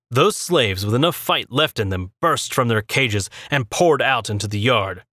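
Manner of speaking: ordinary delivery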